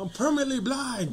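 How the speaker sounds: high, loud melodramatic shout; mock anguished cry. Teasing partner's deafness claim, hammed-up loud wail. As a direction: holler it in pretend despair.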